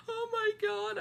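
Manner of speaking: high, emotional gasp of gratitude; overwhelmed gush. Retold or imitated. Fan overwhelmed while thanking her hero, high gush. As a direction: gush it out, overcome with feeling.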